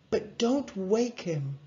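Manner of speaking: careful, drawn-out parent voice; cautioning mum. A narrator's reading. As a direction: warn them not to disturb a sleeper. Mum warns boys.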